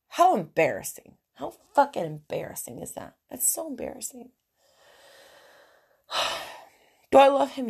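normal talking voice

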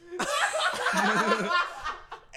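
loud, high laughing fit; big burst of laughter. Real, not acted. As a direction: crack up hard at the memory. Laughs after being hit in face.

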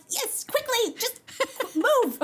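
high, hammed-up urgent command; frantic impatience. Singer rushing to the stage, acted frantic impatience. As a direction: push them aside in comic panic. Hurrying past boss to stage.

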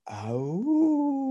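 a faraway howl up high; soft distant howl. Retold or imitated. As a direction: narrator bringing the wolf's song back one last time, softly. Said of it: "Wilbur sang his thank you song".

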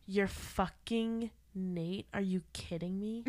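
very slow, drawn-out outraged question; Maddie from Euphoria. Retold or imitated. Maddie confronting a girl about her boyfriend, slow outraged. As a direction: stretch out the accusation, incredulous. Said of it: "she goes".

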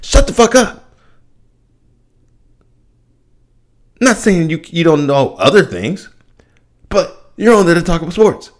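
high-pitched, incredulous outburst; exasperated rant. Real, not acted. Telling ESPN's pundits to shut up, high exasperated yell. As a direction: pitch rising as the frustration boils over.

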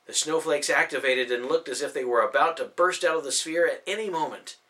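plain reading voice

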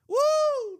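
high, piercing "woo"; loud whoop. Real, not acted. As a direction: let out a sudden ear-splitting whoop. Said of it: "I apologize to your ears".

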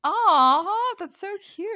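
high loud fond aww; touched aww. Real, not acted. Hearing friend Corey gave the deck, a fond aww. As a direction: coo over the gift.

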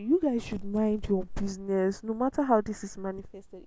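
loud, forceful scolding; angry. Real, not acted. Vlogger telling body shamers off, loud and angry. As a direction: tell them off with real heat. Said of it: "I'm angry".

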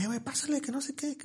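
high-pitched imitation of a friend; friend's voice. Friend greeting him at the door, high mock voice. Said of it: "Me dicen".

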